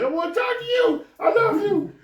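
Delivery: very high falsetto plea with a laugh; hammed-up lovesick girlfriend, way up high; high mock girlfriend begging, laughing through it; pleading girlfriend. Girlfriend pleading in parking lot.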